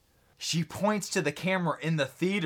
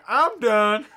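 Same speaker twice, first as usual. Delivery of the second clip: dismissive quitting; 6 semitones higher, 8 dB louder than usual. Pretend. Friend mocked quitting music.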